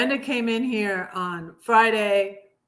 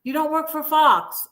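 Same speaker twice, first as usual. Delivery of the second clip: higher-pitched mock dismay; mock realization; 5 semitones higher than usual. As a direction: repeat it higher, as a sarcastic discovery. Mock realization repeated with emphasis.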